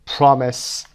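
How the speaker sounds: "promise" stressed on PROM; PROM-ise, drawn out with weight up front; stress on first syllable. Shown as a demonstration. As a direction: hit the PROM in "promise", light second syllable. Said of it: "اونهایی که first syllable هستن".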